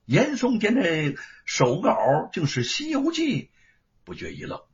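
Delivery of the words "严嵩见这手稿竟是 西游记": ordinary delivery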